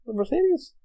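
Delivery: high, rising double-take; puzzled self-check, voice lifting at the end; questioning himself. Real, not acted. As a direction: doubt it aloud, pitch jumping up. Questions own memory of car.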